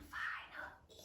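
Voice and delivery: very soft, hushed whisper. Shown as a demonstration. Whispers the silent letter.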